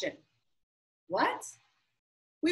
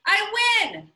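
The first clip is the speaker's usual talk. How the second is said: loud, high cry of victory; gleeful winner's yell; triumphant shout; 8 semitones higher, 5 dB louder than usual. Acted. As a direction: shout it out like you just won. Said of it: "Yasmin shouted".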